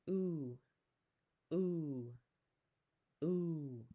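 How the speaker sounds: "Ooh" as the vowel in tooth, drawn out; vowel sound demo. Shown as a demonstration. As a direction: say "ooh" like the end of boo, each one separate. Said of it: "the O O in tooth".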